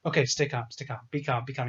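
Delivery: low, hushed self-talk under the breath; muttering to oneself. Retold or imitated. Finn steadying himself during the escape attempt, muttered low to himself. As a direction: quietly talk yourself down, low. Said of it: "No, I'm talking to myself".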